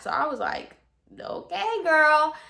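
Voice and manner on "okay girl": mock-agreeable, pitched well up; skeptical giving-in. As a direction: go along with her, voice lifted high. Retold giving in to client.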